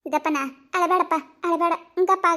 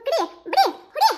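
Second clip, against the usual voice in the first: high laughing dare; 9 semitones higher, 4 dB louder than usual. Acted. Wife laughingly dares her husband to bite and hit. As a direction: voice jumps high, daring him through laughter.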